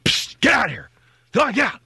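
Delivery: high, loud shoo at the animal; hissed shout to scare it away. Retold or imitated. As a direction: chase the critter off. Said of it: "I'm going".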